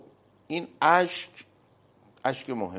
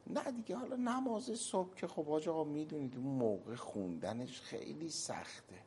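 mock excuse voice; 6 semitones higher, 9 dB quieter than usual. Voicing a lazy excuse-maker.

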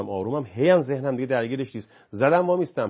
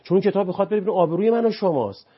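higher, louder, fast heated preaching; impassioned lecture; 7 semitones higher, 6 dB louder than usual. Real, not acted. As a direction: drive the point home with rising passion. Impassioned lecturing, rising intensity.

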